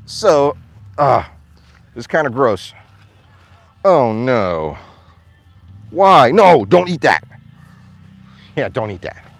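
normal talking voice

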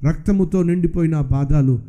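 God telling the believer the footprints are not his. Acted. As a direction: speak low as the Lord revealing the truth. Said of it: "దేవుడు అంటున్నాడు".

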